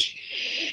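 very soft, breathy shush; hushing shh. Retold or imitated. Dad on his feet shushes his son, soft shh. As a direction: hush someone with a long shh. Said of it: "he goes".

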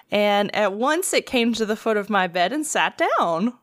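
usual speaking voice

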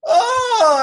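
high loud yelp of disgust; son's yelp. Retold or imitated. Son reacting to the gas smell, loud high yelp. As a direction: shout it out like a kid hit by a stink. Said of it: "my son's like".

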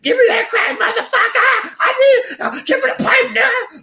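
very high, loud screeching crackhead voice; hammed-up crackhead squeal; crackhead impression. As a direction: shriek for the pipe, half laughing. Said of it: "the two crackheads and they would fight each other over the crack pipe".

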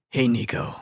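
gruff tough-guy greeting; Snake Plissken. Shown as a demonstration. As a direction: greet him as a gruff dude. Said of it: "like, Snake Plissken gruff dude".

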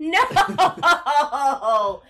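shocked laugh of disbelief. Real, not acted. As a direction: blurt it out through a laugh.

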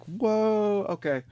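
high, slow drawn-out whoa; amazed whoa. Retold or imitated. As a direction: let the whoa stretch in awe. Recalling being amazed by music.

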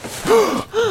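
sharp high gasp of surprise; a quick shocked intake of breath; startled gasp. Acted. Son gasps at the paw, startled gasp.

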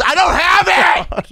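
very high, drawn-out loud yell; mock shout. Maskless customer insisting he is healthy, high mock yell. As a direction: imitate an outraged man hollering. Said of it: "Like that".

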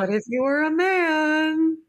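high, slow, teasing; drawn-out pointed question; mock rhetorical question. Real, not acted. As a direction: raise it up slow and knowing.

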